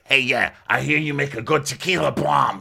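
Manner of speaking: high, lively impression of a man ordering; Frank. Frank ordering a drink from the servers, high hammed-up voice. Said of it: "And he's like".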